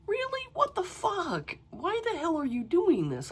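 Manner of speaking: high-pitched retold incredulity; exasperated disbelief. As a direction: squeak out your disbelief without raising the volume. Retells exasperated reaction to character.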